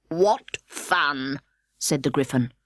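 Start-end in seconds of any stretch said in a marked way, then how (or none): 0.1-1.4 half to itself. Acted. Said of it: "half to itself, half to Alice".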